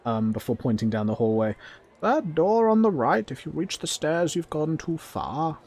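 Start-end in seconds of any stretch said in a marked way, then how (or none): 2.0-5.6 high goblin voice, loud at first; bossy goblin. Acted. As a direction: give directions as a high-pitched goblin. Goblin gives directions.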